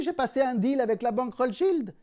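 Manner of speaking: seller's triumphant loud retort; loud punchline. Acted. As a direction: deliver the punchline big. Punchline delivered loudly.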